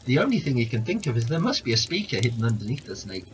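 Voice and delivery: ordinary delivery, nothing marked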